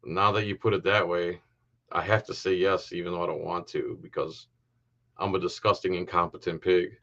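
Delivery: low, soft, grudging mimicry; reluctant cop impression. Commentator voices the cop's grudging yes, mock defeated. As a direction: sink down into a mock reluctant admission. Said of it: "you can even hear the inflection, the way he says yes is like".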